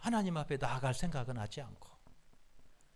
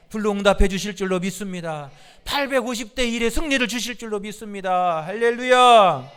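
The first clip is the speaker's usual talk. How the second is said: climactic preaching; 6 semitones higher, 12 dB louder than usual. Real, not acted. Preacher declaring victory to congregation, climactic. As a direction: build to a triumphant pulpit cry.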